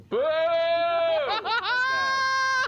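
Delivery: long, high, loud booing shout; crowd booing. Retold or imitated. Comic retelling the crowd booing him, full loud boo. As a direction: drag out a big crowd boo. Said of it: "they're just going".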